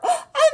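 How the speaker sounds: high, loud mock gasp; delighted gasp. Librarian gasping at the bunny's boots. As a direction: gasp in surprise.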